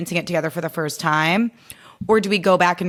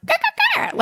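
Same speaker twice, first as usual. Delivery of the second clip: very high clicking crow call; squeaky crow clicks aimed at the little bird; angry crow clicks. Retold or imitated. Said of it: "And the crow's like".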